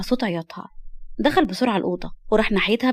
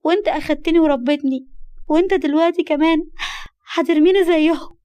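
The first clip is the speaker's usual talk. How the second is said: tearful, crushed; 6 semitones higher than usual. Acted. Girl fearing her guardian will abandon her, tearful and heartbroken. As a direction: accuse him through tears. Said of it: "وكملت بدموع وقهرة".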